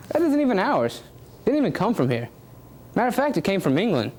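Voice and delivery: ordinary talk, nothing marked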